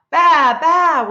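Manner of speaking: a happy baa from the freed sheep; sheep bleat. Acted. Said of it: "went the sheep".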